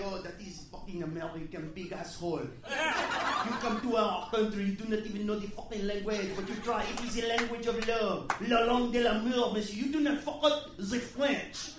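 put-on French accent, indignant; pompous French-accented scolding; haughty French waiter. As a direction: lecture from a high horse in a French accent. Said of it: "on that fucking little high horse".

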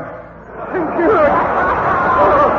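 high, loud comic military voice; blustering old major. Acted.

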